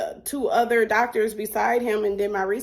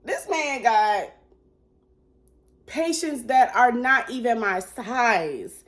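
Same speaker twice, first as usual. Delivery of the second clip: disbelieving drawl; 4 semitones higher, slower than usual. Retold or imitated. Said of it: "And I'm like".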